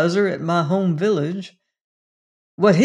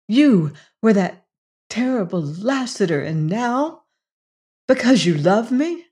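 higher, trembling with disbelief; overwhelmed wonder; 4 semitones higher than usual. A narrator's reading. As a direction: ask it as if you can hardly believe it. Jane overwhelmed by Lassiter's confession.

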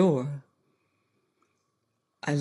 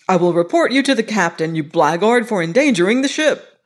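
raised, loud and furious at the steward; a captain-class passenger scolding, higher and louder; angry rebuke; 4 semitones higher, 6 dB louder than usual. Acted. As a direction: tear into the careless servant. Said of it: "I was exceedingly wroth".

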